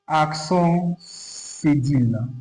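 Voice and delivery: "accent cédille" in French, slow and separated; drawn-out French pronunciation of "accent cédille"; French term. Shown as a demonstration. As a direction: model "accent cédille" slowly for learners. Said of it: "every accent in French has name".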